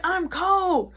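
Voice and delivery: high-pitched complaint; protesting. Retold or imitated. Answering the mad girl, high protesting complaint.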